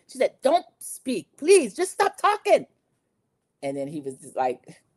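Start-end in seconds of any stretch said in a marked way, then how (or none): 0.4-2.7 high-pitched, upset imitation, climbing higher; mock exasperated pleading. Maura silencing Rob after betrayal, mock exasperated. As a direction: cut him off with a hammed-up plea. Betrayed contestant cuts him off, acted.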